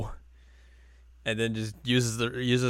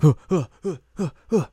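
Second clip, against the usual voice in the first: loud mock panting gasps; 7 semitones higher, 8 dB louder, faster than usual. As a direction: gasp awake like from a nightmare. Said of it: "is like".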